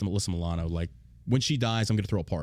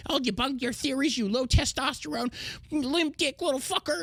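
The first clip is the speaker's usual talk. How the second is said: much higher, slowing mock falsetto; 13 semitones higher than usual. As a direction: sneer it in a high put-on voice.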